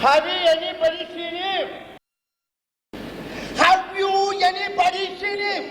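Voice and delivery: shouting the question. Acted. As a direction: bellow it slowly, word by word. (உரத்த கூச்சல்)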